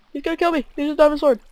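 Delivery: high, loud yelp of alarm; panicked shout. Real, not acted. Player yelling as an attacker with a diamond sword comes, panicked shout. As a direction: cry out as if about to be killed. Attacked while playing the game.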